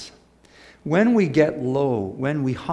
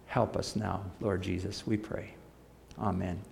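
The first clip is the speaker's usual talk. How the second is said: soft, hushed prayer; 5 semitones lower, 10 dB quieter than usual. Real, not acted. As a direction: pray it quietly. Closing prayer.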